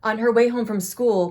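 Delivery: usual speaking voice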